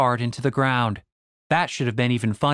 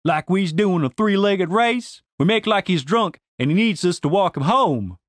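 lightly voiced Southern schemer; 4 semitones higher than usual. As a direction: lightly play someone pitching a scheme. Narrator voices a scheming character.

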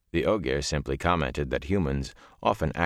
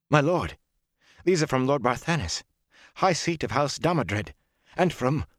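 awed servant; 8 semitones higher than usual. A narrator's reading. Servant marvels at noble seals, excited and higher. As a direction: rush in with wide-eyed wonder.

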